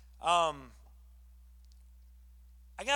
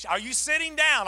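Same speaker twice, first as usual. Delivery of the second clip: higher, louder teasing question; excited question; 8 semitones higher, 7 dB louder than usual. Retold or imitated. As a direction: Linda building suspense, higher and louder. Linda repeating question excitedly.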